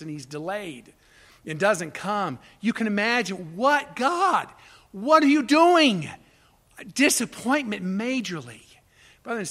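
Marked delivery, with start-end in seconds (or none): 3.6-6.1 much higher and louder, slow; anguished cry. Retold or imitated. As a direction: cry out as the grieving sisters. Mary and Martha's cry.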